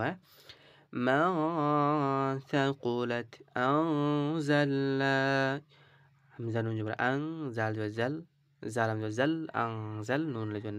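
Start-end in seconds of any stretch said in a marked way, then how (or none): 1.0-5.1 মান held through the nose, slowly recited; nasal ghunnah demo. Shown as a demonstration. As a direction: hum মান then read on slowly. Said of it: "এটাকে গুণনা করবো". (মান এ গুন্নাহ করে ধীরে পড়া)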